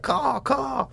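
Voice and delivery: high bird caw, twice; cawing like a crow. Retold or imitated. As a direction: squawk like a crow up at him. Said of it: "I started cawing like a, like a bird".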